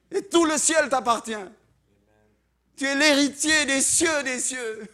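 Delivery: loud, high, booming declaration; grand proclamation. Pretend. Preacher voicing heaven's answer to the believer, loud grand proclamation. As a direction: proclaim it at full force.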